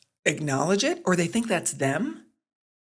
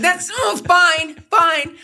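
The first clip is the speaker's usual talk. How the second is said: much higher, louder eye-roll fine-fine; exasperated preteen; 11 semitones higher, 6 dB louder, slower than usual. Retold or imitated. Daughter conceding to mom, exasperated and whiny. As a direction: huff it like a kid giving in. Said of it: "she'll be like".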